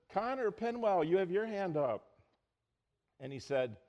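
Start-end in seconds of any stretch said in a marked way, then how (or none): 0.1-2.2 put-on high voice calling on the boy; a lightly voiced church leader calling out; calling on Connor. Church leader calling on Connor in the back, lightly voiced and high.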